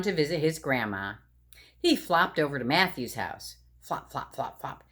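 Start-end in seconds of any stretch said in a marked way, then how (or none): 3.8-4.8 soft flop sounds. Acted. Sound of flopping.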